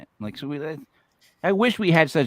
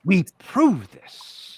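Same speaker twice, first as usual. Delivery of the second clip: mock boast; 3 semitones higher, 4 dB louder, much slower than usual. Mocking boastful ghost hunters.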